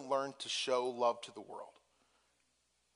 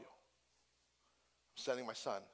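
tender; 3 semitones lower, 9 dB quieter, much slower than usual. Acted. God voiced, slow and soft.

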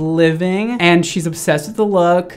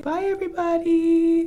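voicing the puppy; 13 semitones higher than usual. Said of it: "Saya, say bye".

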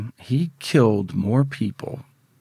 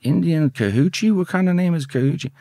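mock incredulous clerk; 7 semitones higher than usual. Clerk squinting at the file, higher and hammed-up puzzled. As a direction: play up her baffled surprise at the name. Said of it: "She goes".

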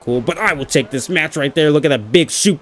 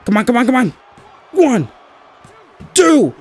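shouting the count; 5 semitones higher than usual. Real, not acted. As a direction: yell out the count. Counting the pin.

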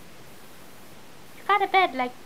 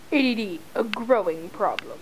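low, quiet mock PSA voice; deadpan voice-over tagline; low mock commercial narrator; mock announcer line; 7 semitones lower, 6 dB quieter than usual.

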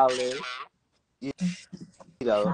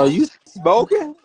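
incredulous; 9 semitones higher, 5 dB louder, slower than usual. Real, not acted. Incredulous teasing question.

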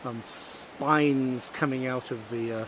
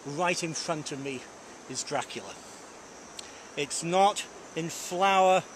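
ordinary delivery

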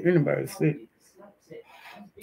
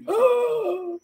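sudden high gasp of shock; sharp gasp. Real, not acted. Streamer gasps at something on screen, shocked.

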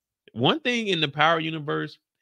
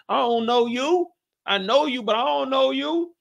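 mock suspicious; 11 semitones higher than usual.

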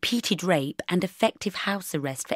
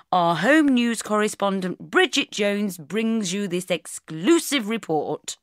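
punchy, pitched-up news intro; TV headline announcer; 3 semitones higher than usual. Acted. Presenter introducing Bridget's exclusive interview, TV announcer. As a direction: announce the exclusive, slowing grandly at the end. Plays back programme headlines.

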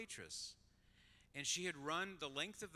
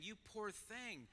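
high, soft, drawn-out pitying coo; slow, hushed sympathy, exaggerated; mock pity; 8 semitones higher, 7 dB quieter, slower than usual. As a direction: imitate someone pitying a poor mother. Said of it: "looking at her saying".

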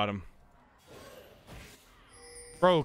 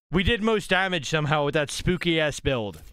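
usual speaking voice

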